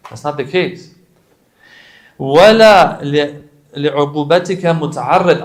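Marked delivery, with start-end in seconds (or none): 2.2-5.4 loud recitation. Acted. Reciting du'a loudly.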